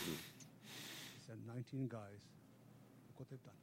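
trailing off so the room can't hear; mumbled under the breath; too quiet. Real, not acted. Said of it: "Speak up, please".

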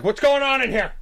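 loud, high bossy cop. Retold or imitated. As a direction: barge in like a cop. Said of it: "I pretended to be the cops".

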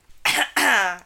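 a real chesty cough from a cold. Real, not acted. Sick cohost coughs before recapping the episode. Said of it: "Sorry, I have a cold".

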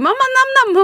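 put-on sing-song like a toddler wanting snacks; imitating little daughter. Mother imitates her toddler begging for corn puffs, high and childish.

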